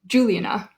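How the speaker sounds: "Juliana" as JU-li-a-na; stress on first syllable. Shown as a demonstration. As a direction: hit the start of "Juliana", rest light. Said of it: "Do we say".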